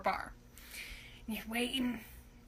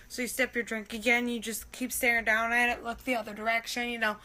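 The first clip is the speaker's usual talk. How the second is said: ordinary delivery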